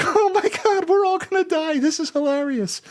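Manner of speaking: high, giddy squeal of doom; mock hysterical glee. Crew laughing through a hurricane, high giddy squeal. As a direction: squeal it like a giddy doomed sailor.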